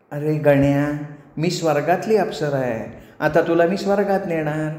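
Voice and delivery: sweet but commanding celestial nymph; possessive apsara. Acted. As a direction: claim him in a silky feminine voice. Menaka claims Ganya. (हक्क गाजवणारी मोहक अप्सरा)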